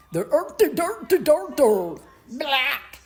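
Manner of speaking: high, hammed-up Dutch accent gibberish; mock Dutch accent. Imitating immigrants opening their mouths, mock Dutch accent. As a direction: mimic a Dutch accent, loud and exaggerated. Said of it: "pop out with that Dutch accent".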